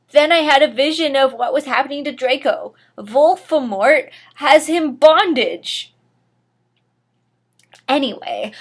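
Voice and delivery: plain reading voice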